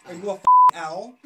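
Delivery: very loud, exasperated shout; loud mocking yell. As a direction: blast it at him in disbelief. Mocking the repeated who.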